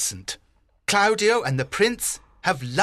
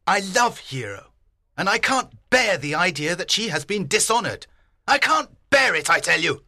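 angry shout. A narrator's reading. Old father to his brother over his dishonored daughter, angry outburst. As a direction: protest hotly, rising on "can't bear it". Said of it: "he shouted".

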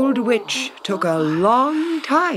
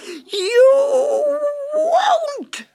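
even higher, crying shriek of rage; wild witch scream; 5 dB louder than usual. A narrator's reading. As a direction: let the scream break into a wail. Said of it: "screamed the witch".

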